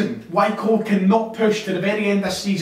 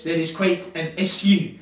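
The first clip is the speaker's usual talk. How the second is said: ordinary talk, nothing marked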